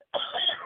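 brief quiet cough. Real, not acted. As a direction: cough into the pause. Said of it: "Excuse me again".

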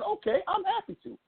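pitched well up, sugary mock-cheerful "happy to". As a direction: play it falsely sweet, barely hiding the irritation. Sarcastic offer to teach her.

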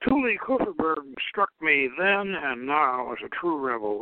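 usual speaking voice

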